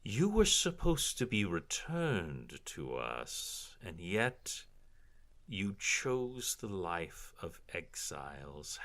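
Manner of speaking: slow, drawn-out villainess voice; condescending drow noblewoman, savoring each word; haughty drow matron. Acted. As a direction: purr it slowly with disdain.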